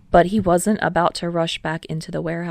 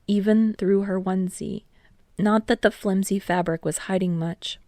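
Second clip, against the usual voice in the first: usual speaking voice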